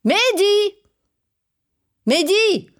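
calling out. Acted. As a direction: call the boy from the house. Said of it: "Papé appelle Mehdi".

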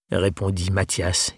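ordinary talk

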